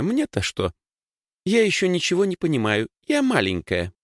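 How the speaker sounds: put-on high girlish voice, feigning ignorance; little sister playing innocent. A narrator's reading. (высоким голосом маленькой девочки, прикидываясь непонимающей)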